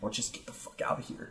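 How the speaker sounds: muttered curses, whispered; cussing under breath. Retold or imitated. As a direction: mutter it like a crazy person. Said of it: "cussing under my breath like a crazy person".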